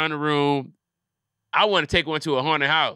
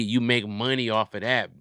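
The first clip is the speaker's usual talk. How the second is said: ordinary talk, nothing marked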